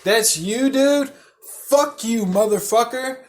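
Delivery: retold accusatory outburst; blurted outburst. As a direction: blurt it out in angry disbelief. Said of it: "I literally blurt this shit out".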